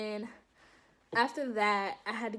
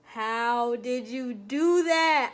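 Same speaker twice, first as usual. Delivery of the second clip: slow, drawn-out wonder; fascinated amazement; 6 dB louder, much slower than usual. Retold or imitated. Recruit asking the doctor about the painless draw, slow amazed wonder. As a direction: marvel at the trick. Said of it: "I'm just like".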